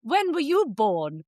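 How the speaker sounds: put-on slow, lightly posh young man; mock a posh dinner guest; a hint of a posh lad; posh guy. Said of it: "the guy is very posh".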